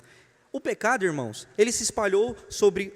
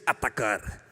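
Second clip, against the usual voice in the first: deep, hammed-up battle order; ant leader's command; 8 semitones lower, slower than usual. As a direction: give the order in a low leader's voice. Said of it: "o líder disse".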